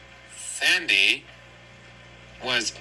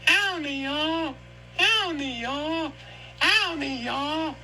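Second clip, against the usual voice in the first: high, repeated Texan plea; Texan cry for help; 14 semitones higher, slower than usual. Acted. Sandy calling y'all for help, high Texan accent. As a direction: call for help like a cartoon cowboy.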